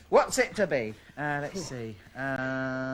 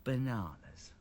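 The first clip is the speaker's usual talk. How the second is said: hushed, low story voice; soft, restrained near-whisper; 5 semitones lower, 9 dB quieter, slower than usual. A narrator's reading. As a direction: say it very softly, toned right down.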